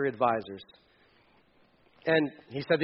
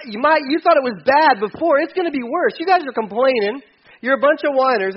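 much higher, louder mocking scorn; bully king; 9 semitones higher, 7 dB louder than usual. King scorning the complaining people, loud mocking. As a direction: sneer at the whiners.

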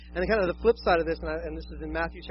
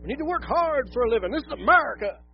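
mock bootstraps lecture; 6 semitones higher, 4 dB louder than usual. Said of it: "you're like".

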